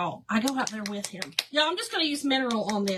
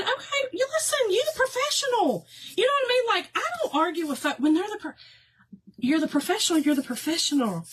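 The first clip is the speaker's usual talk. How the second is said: high, sing-song deference; mock deference; 7 semitones higher, faster than usual. To the cable guy, high sing-song mock deference. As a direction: defer to him with a knowing lilt.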